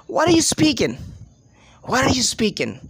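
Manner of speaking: "What are you" squashed into "speaking"; connected speech. Shown as a demonstration. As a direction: link it all, land on "speaking". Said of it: "that is basically called connected speech".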